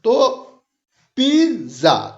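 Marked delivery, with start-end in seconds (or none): 1.2-2.1 pizza with पी stretched long, ज़ा quicker; stress on first syllable. Shown as a demonstration. Teacher demonstrating stressed and unstressed syllables, पी stretched. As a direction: draw out the पी of pizza, let ज़ा drop. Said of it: "पी को हम थोड़ा खींच रहे हैं".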